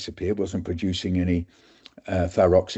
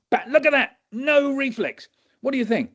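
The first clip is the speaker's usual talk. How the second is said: much higher, gleeful; excited doctor. Retold or imitated. Doctor quizzes students, high. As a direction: show off the missing reflex.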